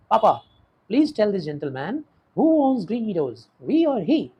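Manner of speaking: agitated appeal. Acted. Daughter appeals to father in quarrel.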